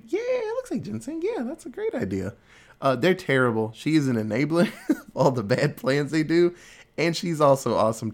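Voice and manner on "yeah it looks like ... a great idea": squeaky high mock stepmom, eager and agreeing. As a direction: play the enabler stepmom in a much higher put-on voice. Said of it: "And she's like".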